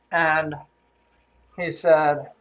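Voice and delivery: usual speaking voice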